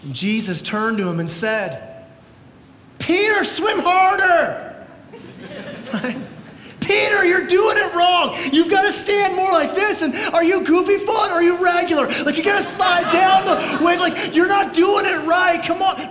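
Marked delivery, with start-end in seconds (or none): 3.0-4.7 mock coach. Mock Jesus voice, joking. 6.8-16.1 high, loud hammed-up coaching shout; mock surf coach. Joking Jesus coaching sinking Peter, hammed-up loud shouting.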